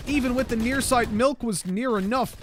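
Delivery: high, loud hype call; esports caster. Acted. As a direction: ride the tension of the retake.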